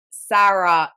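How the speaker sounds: "Sarah" loud, with open vowels, SA-rah; imitated Montreal pronunciation. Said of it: "trying to talk like them".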